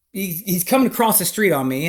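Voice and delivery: normal talking voice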